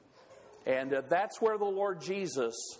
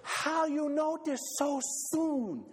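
Lena's voice; 10 semitones higher than usual. Acted. Lena asking Ole how he knew, high and amazed. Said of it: "And she says".

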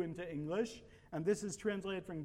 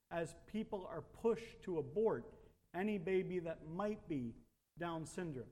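ordinary talk, nothing marked

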